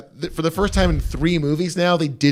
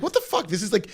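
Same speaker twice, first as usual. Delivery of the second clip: normal talking voice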